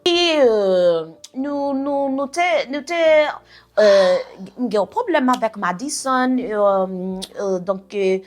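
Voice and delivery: lightly voiced halting French full of euhs; mocking cousin. Cousin Yolanda rambling in French on the phone, mild mockery. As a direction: stumble around the point like she does. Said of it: "This is how she freaking talks".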